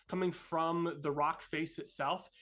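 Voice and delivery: usual speaking voice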